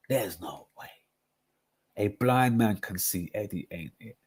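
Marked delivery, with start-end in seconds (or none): 0.1-0.9 "There's no way" breathed as a whisper; hushed, defeated disbelief. Real, not acted. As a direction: whisper it, stunned. Disbelief repeated quietly.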